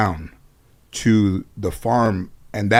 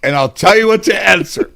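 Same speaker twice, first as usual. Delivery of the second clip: grandmother voice; 9 semitones higher, 4 dB louder than usual. Retold or imitated. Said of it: "my grandmother said".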